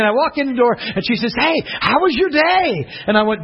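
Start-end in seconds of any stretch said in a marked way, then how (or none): 1.4-2.9 high, drawn-out eager greeting; slow, sing-out high hello; very excited greeting. Retold or imitated. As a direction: imitate a thrilled mother-in-law. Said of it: "very excited for me".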